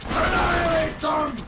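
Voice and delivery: high, frenzied warlord screams for slaughter; raging battle cry. Acted. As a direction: bellow it like a berserker calling for blood. Chaos warrior battle cry.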